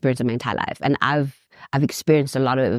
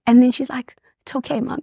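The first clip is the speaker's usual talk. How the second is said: high, strained voice choking up; much higher pitch, holding back tears; real tearful; 10 semitones higher than usual. Real, not acted. Mother retelling her daughter's brave goodbye, tearful.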